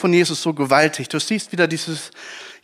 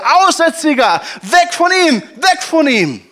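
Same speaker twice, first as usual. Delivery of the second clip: high, loud angry shout to drive him off; crowd yelling; 10 semitones higher, 4 dB louder than usual. Acted. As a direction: yell at the leper to get away. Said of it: "schrien die Leute".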